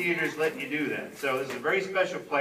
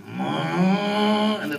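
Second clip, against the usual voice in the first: drawn-out rising pump drone; imitated pump; 3 semitones higher, 5 dB louder than usual. Actor explaining the failed blood effect, imitated pump noise. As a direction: groan like a pump slowly starting up. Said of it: "and the pumps would go".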